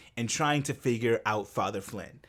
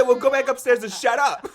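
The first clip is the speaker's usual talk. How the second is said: higher, louder curt brush-off through laughter; impatient dismissal; 9 semitones higher, 6 dB louder than usual. Retold or imitated. Meryl's nun dismissing the student, impatient and laughing. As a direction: shoo him away, laughing as you imitate. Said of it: "the impatience".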